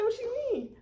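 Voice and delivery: high, eager ask; surprised question. Retold or imitated. Maya asking again, high-pitched. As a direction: pitch it up with curiosity. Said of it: "She's like".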